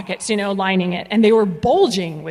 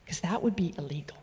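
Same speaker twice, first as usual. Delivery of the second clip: breathy whispered joke; conspiratorial whisper aside; 5 semitones lower, 12 dB quieter than usual. Real, not acted. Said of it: "whispered aside".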